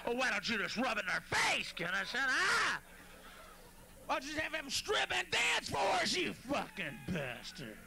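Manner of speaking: hammed-up jealous shouting; jealous heckling. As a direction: yell at him like a jealous guy. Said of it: "the other half of guys are going".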